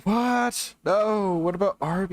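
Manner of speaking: high, whiny protest; high-pitched whine. Real, not acted. Pleading with friend not to leave.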